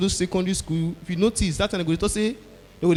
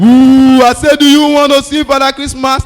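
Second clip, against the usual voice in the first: high, loud spooky Santa roar; booming spooky Father Christmas, at full force; scary Santa, all out; 6 semitones higher, 13 dB louder, slower than usual. Retold or imitated. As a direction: give it everything to scare him. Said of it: "third time".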